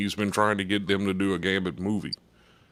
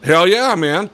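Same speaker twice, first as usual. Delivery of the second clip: higher, louder burst on the hell yeah; eager acceptance; 5 semitones higher, 7 dB louder, slower than usual. Retold or imitated. He jumps at the role without hesitating, eager. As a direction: imitate someone jumping at an offer.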